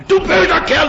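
schrill und laut keifend, viel höher; keifende Mutter. Retold or imitated. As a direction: eine Mutter, die ihr hingefallenes Kind anschreit. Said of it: "kreischen sie auf ihn ein". (zeternde Mutter, die ihr Kind ausschimpft, hoch und laut)

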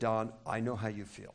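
soft, calming empathy. Retold or imitated. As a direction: soothe an angry man quietly. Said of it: "lowered my voice real low". (ruhig und verständnisvoll)

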